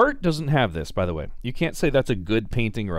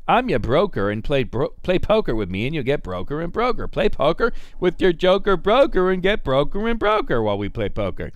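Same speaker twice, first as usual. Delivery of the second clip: slick singsong rhyme, higher and louder; broker voice; 5 semitones higher, 4 dB louder than usual. Retold or imitated. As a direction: ham up the smooth-talking broker. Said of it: "I always talk like that when I talk like a broker".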